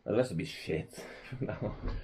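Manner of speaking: usual speaking voice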